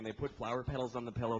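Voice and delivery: ordinary talk, nothing marked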